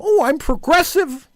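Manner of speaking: high, slow sneering mimicry of a smug progressive; mocking progressive. Host mocking his political opponents in a high, smug voice. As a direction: mimic them in a prissy, preening voice. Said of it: "they tell me".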